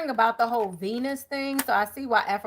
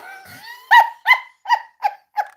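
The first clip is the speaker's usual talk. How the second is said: long, loud, high-pitched cackling laugh; 9 dB louder than usual. Real, not acted. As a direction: give in to a helpless laughing fit. Long laughing fit after yawns.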